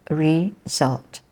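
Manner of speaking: "result" broken into re, sult; split syllables. Shown as a demonstration. Teacher counts two syllables for learners, split syllables. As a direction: say "result" in two separate beats. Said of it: "two syllables".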